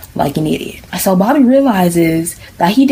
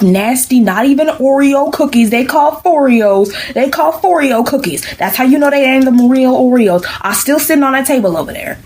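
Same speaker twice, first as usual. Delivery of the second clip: high-pitched, scornful comic rant; indignant rebuttal; 6 semitones higher than usual. Acted. Algebra denying the cookie theft to Bobby, indignant. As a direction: pile up the insults in a raised, offended pitch.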